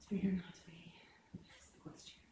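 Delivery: whispered soliloquy. Shown as a demonstration. Teacher shows a class that a whisper can't fill the theatre. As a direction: whisper the lines as if nobody must hear. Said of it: "You can't stand on the edge of the stage and go".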